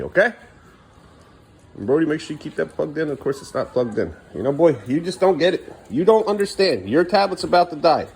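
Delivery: normal talking voice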